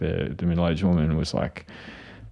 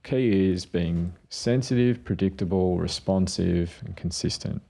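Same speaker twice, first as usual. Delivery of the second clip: slow listing; slower than usual. Retold or imitated. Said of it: "I was like, "Mate".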